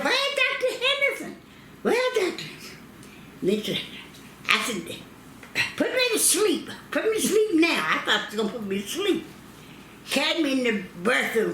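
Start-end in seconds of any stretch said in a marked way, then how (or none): 0.0-2.4 high, frantic calling for the doctor; pitched way up, calling out in panic; panicked high cry. Retold or imitated. Woman in labor calling for her doctor, high and frantic. 5.8-7.8 high, urgent begging; desperate plea. Retold or imitated. Mother in labor begging the nurse, high and desperate.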